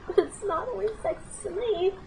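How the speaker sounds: high, slow, laughing through the words; laughing confession. Real, not acted. Admitting to the camera it mattered, laughing. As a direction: laugh at your own feelings.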